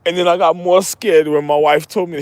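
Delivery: normal talking voice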